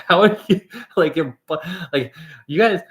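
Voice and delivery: high, loud amazed sputtering; hammed-up stammering amazement; astonished disbelief. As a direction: gush in disbelief at the giants. Retold disbelief at Canadians.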